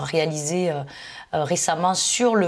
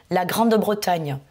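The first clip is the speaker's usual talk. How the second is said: normal talking voice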